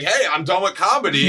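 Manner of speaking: high-pitched, mock-casual declaration; imitating a carefree career switch; breezy announcement. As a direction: announce it brightly as someone else's big decision. Breezy acted quote of Jordan Peele.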